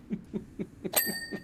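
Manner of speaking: high-pitched helpless laugh; embarrassed giggle at the memory; high squealing laugh. Real, not acted. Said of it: "laughing at own story".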